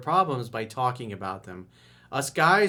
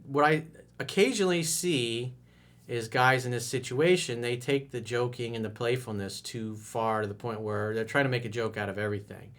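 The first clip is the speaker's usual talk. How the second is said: normal talking voice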